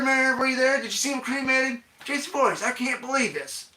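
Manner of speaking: loud, high-pitched disbelieving rant; ballistic mayor. Acted. Mayor hearing the killer's name, ballistic hollering. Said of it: "that's when the mayor goes ballistic".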